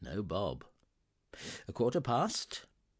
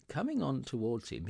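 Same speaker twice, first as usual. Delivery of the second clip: ordinary delivery, nothing marked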